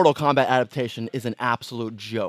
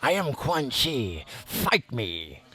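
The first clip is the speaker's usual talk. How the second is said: Quan Chi voice; 2 semitones lower, 6 dB quieter, much slower than usual. Pretend. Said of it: "I'm gonna talk like that".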